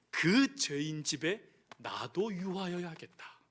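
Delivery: low, slow and soft; gentle Lord. Acted. Jesus telling Zacchaeus he will stay, slow and gentle. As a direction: say it gently to the sinner.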